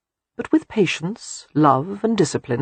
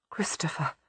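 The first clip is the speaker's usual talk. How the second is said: a breathless whisper of his name; whispered in disbelief; shocked whisper; 14 dB quieter than usual. A narrator's reading. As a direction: hush it out, aghast. Audrey ashen, shocked at betrothal.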